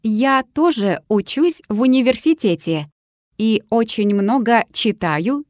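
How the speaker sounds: ordinary delivery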